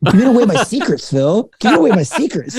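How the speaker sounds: high-pitched, playful accusation through laughter; laughing mock protest. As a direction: tease a friend for spilling your secrets. Mock protest at co-host, laughter heard.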